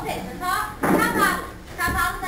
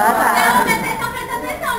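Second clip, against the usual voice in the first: loud teasing; 7 semitones higher, 10 dB louder, faster than usual. Real, not acted. Teasing audience for not answering.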